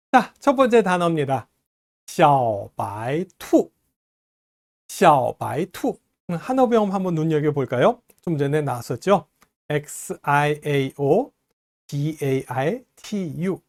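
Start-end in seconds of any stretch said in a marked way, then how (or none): 2.1-6.0 very slow, syllable by syllable; slow word demonstration. Shown as a demonstration. Teacher introducing the first new word to learners, slowly. 9.7-13.6 slow, letter by letter; spelling out slowly. Shown as a demonstration. Teacher spelling out the pinyin for learners, slowly.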